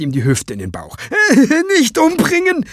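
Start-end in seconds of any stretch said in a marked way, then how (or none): 1.0-1.7 panicked high wail. Acted. Terrified man bursts from cupboard. 1.8-2.7 high, loud sobbing cry for mercy; sobbing plea. A narrator's reading. Terrified man begs Walküre not to kill him, sobbing. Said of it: "schluchzte er".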